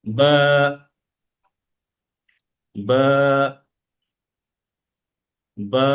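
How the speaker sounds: বা with a light, short lengthening of the vowel; short stretch on ba. Shown as a demonstration. Teacher shows the short drawl on বা. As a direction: hold the আ of বা just a little. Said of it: "আমি যখন উচ্চারণ করলাম বা".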